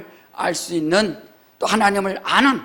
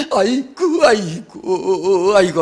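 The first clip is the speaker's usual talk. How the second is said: hammed-up ritual keening, stretching each aigo very slowly; mock funeral wailing, slow and drawn out; imitating a mourning wail; 4 dB louder, much slower than usual. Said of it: "하면서 또 울어야 돼요".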